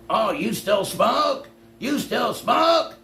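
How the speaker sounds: high, slow mocking voice aping critics; mocking nag. Streamer mocking chat critics, high and slow. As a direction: drag out smoke like a taunting heckler. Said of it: "people used to bust my balls".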